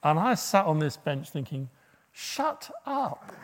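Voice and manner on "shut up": mock inner retort.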